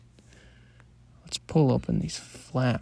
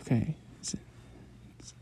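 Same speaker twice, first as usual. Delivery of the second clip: whisper; 12 dB quieter than usual. Acted. Relieved whisper after kill.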